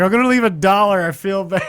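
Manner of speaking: high, loud voice through laughter; laughing retelling. Retold or imitated. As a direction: crack up while quoting yourself. Laughing at own retold quote.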